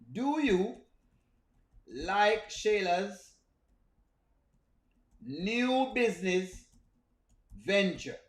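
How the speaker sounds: very slow with pauses between words; slow spaced question. Shown as a demonstration. Streamer quizzing chat about Shayla, slow and spaced. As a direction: stretch the question out word by word. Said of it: "drawn-out question to chat".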